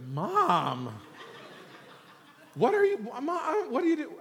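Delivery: very high, mock-mortified whine at Mom; embarrassed son. Son to his mom in front of nine friends, embarrassed. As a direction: squeal it like a kid shamed by his mother. Sons embarrassed by mother's request.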